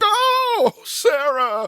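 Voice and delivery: put-on thrilled greeting of an old friend; excited recognition. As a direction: burst out with joy at the name. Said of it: "He was like".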